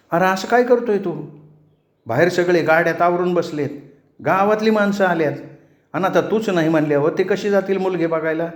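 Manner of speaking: high, fast and urgent protest; pleading. A narrator's reading. Sister urges her brother that everyone is waiting, high and fast. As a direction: push hard to change his mind.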